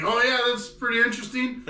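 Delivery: high oblivious voice; imitating him. Mocking the oblivious guy being seduced, high voice. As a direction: play clueless and chirpy. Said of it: "he's just like".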